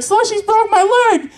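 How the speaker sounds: put-on high, tattling child's wail; mock screaming.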